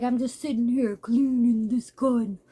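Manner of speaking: understated intimidating father; menacing dad. Retold or imitated. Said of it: "if it was the dad that's like".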